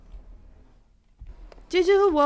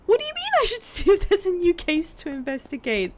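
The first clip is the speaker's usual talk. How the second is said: baffled protest; 6 semitones higher than usual. Real, not acted. Baffled protest at game's instruction.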